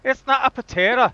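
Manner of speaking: high, loud mock Scottish; hammed-up Scottish protest; bad Scottish accent. Arguing over a potato, bad Scottish accent. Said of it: "I can do a Scottish accent much better".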